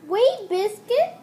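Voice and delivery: high, loud, slow call to the dog; urgent girl. Acted. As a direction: call out to stop the puppy. Girl calls dog, first read.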